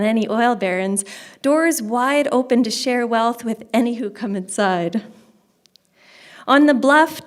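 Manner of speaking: poetry reading. Acted. Poet reading about the forest to an audience, projected recital. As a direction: open each stanza strongly, settle as it ends. Reads own poem aloud.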